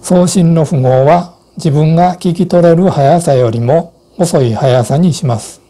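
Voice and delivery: ordinary delivery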